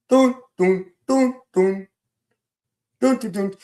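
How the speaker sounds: mock suspense jingle; dramatic dun dun. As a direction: hum a dramatic reveal sting. Dramatic fanfare sound.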